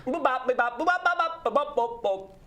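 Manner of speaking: high squeaky nonsense babble; clown gibberish voice. Acted. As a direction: babble like a toy robot clown. Clown speaks gibberish language.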